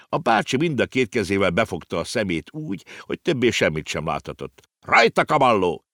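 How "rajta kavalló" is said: higher, louder call to charge; rallying cry. A narrator's reading. Uncle urges the horse to gallop: rallying cry. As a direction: spur the horse on. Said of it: "kiáltotta el magát Ringelhut".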